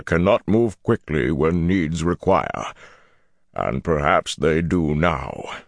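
slow, deliberate Ogier elder with a gravel-slide voice; slow gravelly tones. A narrator's reading. Ogier elder reluctantly agreeing to haste, slow gravelly tones. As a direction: weigh every word, ponderously. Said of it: "said in slow tones like gravel sliding".

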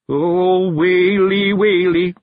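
high, loud, drawn-out keening; mock wailing. A narrator's reading. Daft Woolly begins lamenting, hammed-up wail.

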